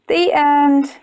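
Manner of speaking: usual speaking voice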